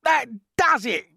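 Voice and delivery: high and loud despair; cried out. A narrator's reading. Earthworm panicking about sinking, crying out. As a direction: throw up your hands. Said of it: "cried the earthworm".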